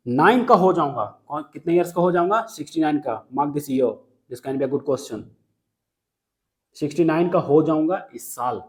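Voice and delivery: ordinary talk, nothing marked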